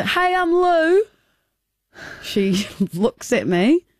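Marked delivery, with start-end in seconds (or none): 0.0-1.0 high, slow friendly hello; eager greeting. Retold or imitated. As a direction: introduce yourself brightly. Retold eager self introduction.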